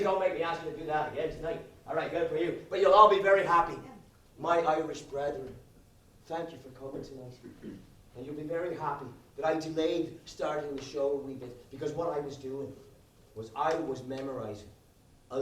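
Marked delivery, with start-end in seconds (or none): none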